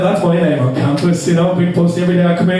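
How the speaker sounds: ordinary talk, nothing marked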